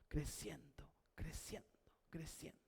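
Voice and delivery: hushed, echo-like fade; fading whisper. Real, not acted. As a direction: let the word trail off into a whisper. Repeats word, fading like an echo.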